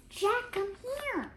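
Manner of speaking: high, slow call from afar. Acted. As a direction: draw out the call to her brother. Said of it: "Annie called".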